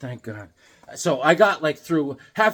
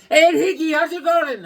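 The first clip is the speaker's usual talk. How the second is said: imitating a cheery clerk, higher and louder; Amir's greeting; 10 semitones higher, 7 dB louder than usual. Clerk Amir greeting Hickey, higher and louder. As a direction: pitch it up as the gas station clerk. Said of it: "He's like".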